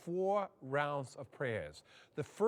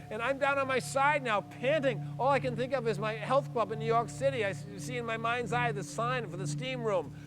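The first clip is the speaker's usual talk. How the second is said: ordinary talk, nothing marked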